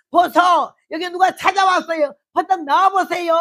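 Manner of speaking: high, loud call to husband. Retold or imitated. Wife shouting to husband that a friend came, loud. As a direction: yell the news across the room. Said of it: "근데 왜 이렇게 소리 질러?".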